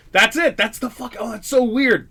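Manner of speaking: sudden high, loud burst of recognition; surprised recognition. Real, not acted. The comic spots the club site and blurts out in surprise. Spotting the old club location.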